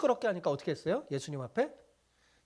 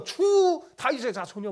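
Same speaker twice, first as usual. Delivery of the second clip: slow, high, loud plea; crying out to the Lord; 7 semitones higher, 6 dB louder, slower than usual. Retold or imitated. Preacher voicing the woman's cry to Jesus, loud slow plea. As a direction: call out like a desperate mother begging for help. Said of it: "막 이렇게 고백했는데".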